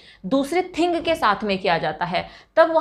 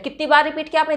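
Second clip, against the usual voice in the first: normal talking voice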